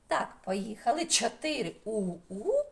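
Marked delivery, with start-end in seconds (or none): none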